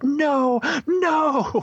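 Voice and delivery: high, drawn-out no; long, high-pitched imitated "no"; mock wail. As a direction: wail it out in imitation of her protest. Retelling her refusal to go back.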